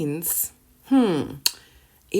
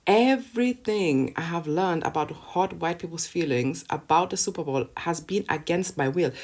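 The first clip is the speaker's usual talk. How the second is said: usual speaking voice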